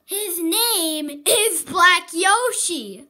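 slow, loud and firm; loud emphatic. Real, not acted. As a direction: lay down the name firmly. Insisting on a character's name.

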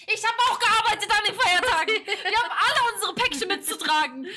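hammed-up retort, laughing while acting indignant; laughing retelling of an indignant comeback; retold outrage. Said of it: "Ich so".

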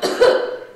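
loud mock cough; demonstrated cough. Shown as a demonstration. Co-host joking on the "cough" memory trick, loud put-on cough. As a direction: punctuate the list with a loud cough.

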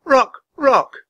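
put-on high, loud girl's call; high-pitched, urgent name calling. A narrator's reading. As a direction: call up to a bird on a high perch. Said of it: "She called up to the bird".

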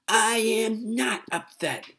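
high, loud, slow shout; child's yell. Acted. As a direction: shout it like a furious little girl. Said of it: "yelled Ramona".

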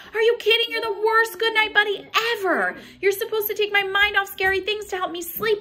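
put-on fed-up child scolding the monster; exasperated kid.

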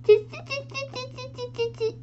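tiny high-pitched monkey chatter. Acted. Monkeys chatter back at peddler.

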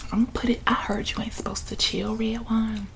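ordinary delivery

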